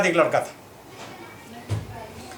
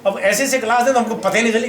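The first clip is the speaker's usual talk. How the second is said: ordinary talk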